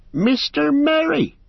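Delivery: high, loud, drawn-out cry of surprise; astonished cry. A narrator's reading. Farmer recognizing Merry in the lantern light, astonished. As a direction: cry out in relief and amazement. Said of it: "he cried".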